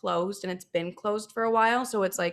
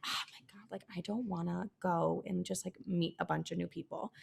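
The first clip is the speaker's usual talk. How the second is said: whispered, dreading it; reluctant whisper; 10 dB quieter than usual. Retold or imitated. Whispering her dread about meeting a bunch of new people. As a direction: breathe it out like you'd rather hide.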